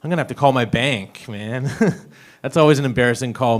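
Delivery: usual speaking voice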